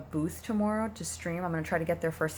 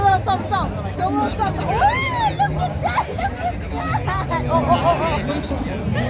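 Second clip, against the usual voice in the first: excited; 8 dB louder than usual. Real, not acted. Friend dancing, she cheers.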